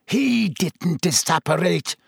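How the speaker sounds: roar. A narrator's reading. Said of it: "Snape roared".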